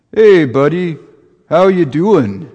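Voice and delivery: put-on light Brooklyn accent on a mall Santa; a hint of a Brooklyn Santa. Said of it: "a Santa with kind of a Brooklyn accent".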